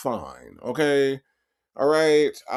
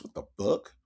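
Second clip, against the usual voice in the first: ordinary talk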